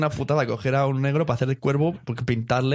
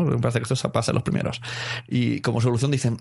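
ordinary talk, nothing marked

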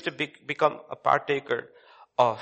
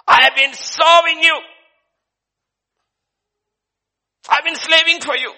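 high, loud, strained wail of grievance; anguished outcry; 12 dB louder than usual. Acted. Elder son wails to father about his slaving, anguished outcry. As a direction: cry out how hard you worked.

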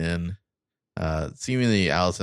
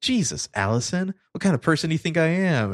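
high-pitched imitated indignation; insulted; 10 semitones higher, 5 dB louder than usual. Mona rebukes Allison for suspecting her, insulted. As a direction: act affronted, pushing up in pitch. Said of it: "Like, insulted".

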